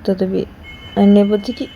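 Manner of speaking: ordinary delivery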